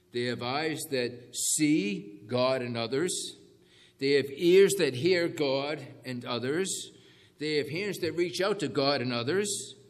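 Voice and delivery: loud, slow, ringing sermon lines; full-force preaching. Acted. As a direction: preach it out to the whole room.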